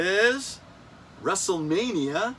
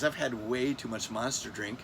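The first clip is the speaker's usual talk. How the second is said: normal talking voice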